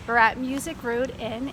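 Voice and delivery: ordinary talk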